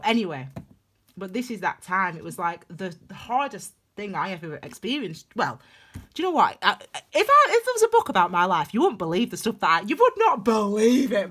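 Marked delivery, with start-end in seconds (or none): 6.1-11.3 loud, high burst of disbelief; exclaiming. Real, not acted. Vlogger telling viewers her life story, loud and high.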